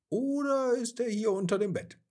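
mother closing in on her hiding son, high and playful; playful searching mother. A narrator's reading. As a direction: tease the child with a knowing guess. Mama finally looks under bed.

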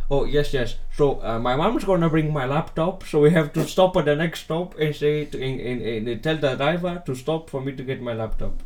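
slow mock Indian accent in English. Imitating the Indian boy's English, slow mock accent. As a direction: drawl it out like the Indian kid. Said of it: "a rir-se assim".

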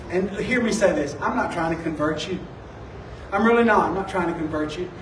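low, soft, sincere offer; gentle reassurance. Retold or imitated. Offering to pray for a coworker's mom, gentle reassurance. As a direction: softly ask permission, putting them at ease.

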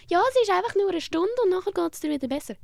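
a high, breezy put-on voice; mock cheerful comforter. Mocking people who promise it passes in an hour, breezy. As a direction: parody glib reassurance.

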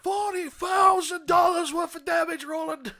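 loud, high disbelieving captain yelling; outraged captain. Acted. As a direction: blow up at the reckless cop. Captain yells at cop.

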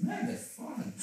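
breathy sarcastic aside, almost whispered; wry, half-voiced irony. Real, not acted. Joking to a friend about his mental health, dry sarcasm.

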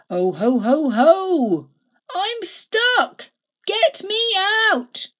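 loud, high Santa cry of ho ho ho and stuck; shout. Acted. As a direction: call down the chimney for rescue. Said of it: "he turns to shout".

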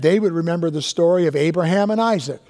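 ordinary talk, nothing marked

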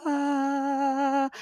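a high, sung heavenly ah like angels; mock angelic choir note. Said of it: "I felt like the angels did the".

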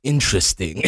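low, muted mock reaction; dry, low understatement; deflated aside. Acts out own deflated high-school reply.

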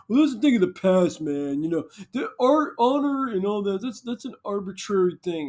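high, snide dismissive voice; high mock know-it-all; mocking careless people. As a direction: parody the people who shrug it off. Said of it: "It's like".

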